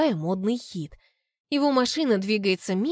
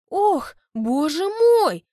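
mock surprise; 8 semitones higher, 5 dB louder, much slower than usual. Mother's faked surprise.

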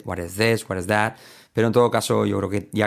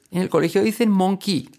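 high child imitation, pitched way up; child's voice; 10 semitones higher than usual. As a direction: play the kid again, raised voice. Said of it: "como un niño me dijo".

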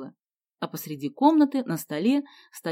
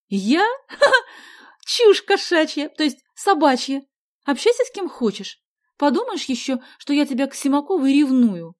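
high, loud huffy protest; scornful denial; 8 semitones higher, 8 dB louder than usual. A narrator's reading. Sonya denying jealousy to Vasya, loud and scornful. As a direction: open on a mock laugh, then scoff.

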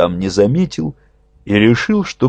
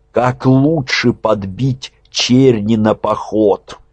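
plain reading voice

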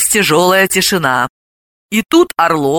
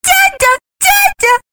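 put-on very high baby voice; little child perking up, squeaky; excited toddler; 3 dB louder, slower than usual. A narrator's reading. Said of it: "Малышка оживилась".

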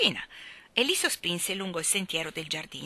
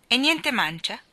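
put-on messenger boy; 5 semitones higher, 6 dB louder than usual. A narrator's reading. Messenger boy asks for tip.

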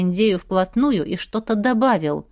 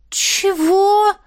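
high-pitched stunned question; 10 semitones higher, 4 dB louder than usual. A narrator's reading. Дуняша hears of the flogging threat, stunned. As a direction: squeak it out, dumbfounded. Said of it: "обомлела Дуняша".

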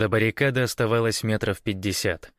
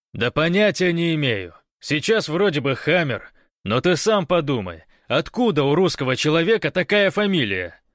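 high, loud protest; exasperated raised voice; shouted explanation; 7 semitones higher, 5 dB louder than usual. A narrator's reading. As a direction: argue back across the barricade. Guest argues loudly from afar.

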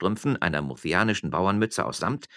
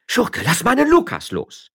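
high, loud, furious demand; heated, indignant cry; agitated; 9 dB louder than usual. A narrator's reading. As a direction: shout in outrage to free your husband. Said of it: "die erregt sagte".

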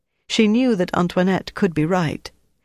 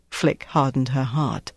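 usual speaking voice

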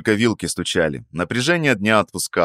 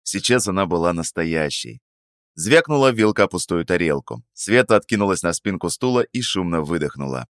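ordinary delivery

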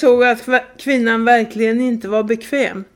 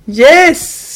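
a loud, high blurted yes; shouted yes; 6 semitones higher, 10 dB louder than usual. Retold or imitated. A nervous traveller shouting at a stranger across the train carriage. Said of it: "skrek jag rakt ut i tågvagnen".

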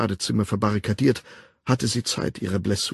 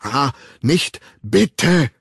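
high, loud pleading in bursts; jerky protest; 4 semitones higher, 6 dB louder, much slower than usual. A narrator's reading. Woman being shaken by the man, jerky protest. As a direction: beg in broken gasps. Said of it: "protestierte sie stoßweise".

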